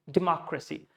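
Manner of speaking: democracy as "di-MOCK-ruh-see"; democracy run together around a strong MOCK; schwa in unstressed syllables. Shown as a demonstration. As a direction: say democracy with MOCK stressed, other vowels reduced. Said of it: "Notation is this way".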